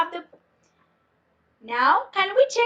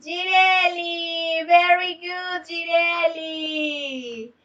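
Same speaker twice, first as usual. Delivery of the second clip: drawn-out, lilting praise; slow, chanted congratulations to a student; singsong praise; 2 semitones higher, slower than usual. Real, not acted. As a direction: sing out the praise, stretching the name. Teacher cheering a student's answer.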